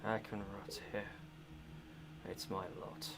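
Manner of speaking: very soft, low, dry rasp; a hopeless prisoner barely speaking; raspy, hoarse voice. Acted. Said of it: "very hoarse voice say".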